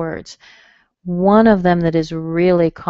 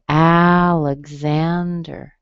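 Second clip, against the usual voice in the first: Alexander drawn out as AL-ig-ZAN-der; third-syllable stress; much slower than usual. Shown as a demonstration. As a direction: stretch Alexander slowly, strong first vowel, stressed ZAN. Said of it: "stressed on the third syllable".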